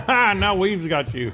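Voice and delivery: loud, slow, laughing glee; gleeful laughing commentary. Real, not acted. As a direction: cheer on the play through laughter. Laughing during play.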